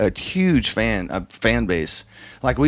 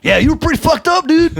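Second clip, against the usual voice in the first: much higher, louder teasing voice; a gleeful jab about last night; Alex teasing; 7 dB louder than usual. Retold or imitated. Alex teases the drummer about his drunken night, high gleeful voice. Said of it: "He goes".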